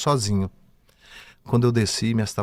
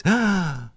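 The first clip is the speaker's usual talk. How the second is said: imitated gasp; 8 semitones higher than usual. Dead woman sits up gasping.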